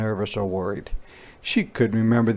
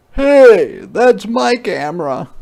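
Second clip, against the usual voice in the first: put-on high, loud boy's protest; a boy calling out for his camera; indignant little boy, loud and high; boy shouting hey; 11 semitones higher, 9 dB louder, slower than usual. Martin calling out.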